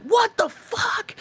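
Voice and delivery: high, slow stunned yell of disbelief; shocked shout. Retold or imitated. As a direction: yell it out in total shock, stretched. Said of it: "I'm like".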